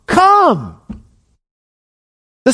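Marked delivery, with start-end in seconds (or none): 0.1-0.8 much higher, drawn out call of come; high calling invitation. Acted. Preacher calling the reluctant listener to come, high pleading call. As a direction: lift the word like calling someone home.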